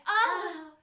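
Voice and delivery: high loud hammed-up yelp; loud comic sound effect; mock yelp. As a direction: yelp as if tossed in. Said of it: "We love to do sound effects".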